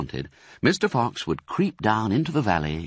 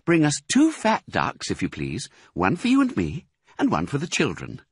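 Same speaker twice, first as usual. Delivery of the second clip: higher, lightly voiced wife; Missus Fox; 8 semitones higher than usual. Missus Fox ordering ducks from her husband, lightly higher. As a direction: ask sweetly. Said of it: "said Missus Fox".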